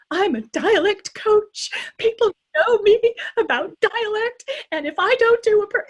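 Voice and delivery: high, loud tearful wail; mock crying. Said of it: "I literally cried. I was like".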